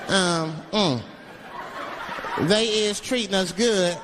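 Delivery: halting, monotone read-aloud as a hostage; mock statement read out haltingly for the cameras; hostage reading letter. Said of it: "reading the hostage letter and shit".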